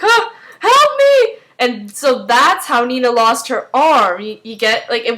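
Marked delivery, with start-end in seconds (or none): 0.0-1.4 gasp, then high, loud plea; hammed-up panicked yelp, drawn out; acted cry for help. Nina with her arm caught in the portal, acted panicked cry.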